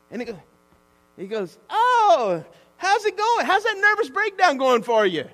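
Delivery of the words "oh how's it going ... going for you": high, loud exaggerated friendliness; hearty greeting. Pastor bumping into troubled woman, high hearty greeting. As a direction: boom it out with showy interest.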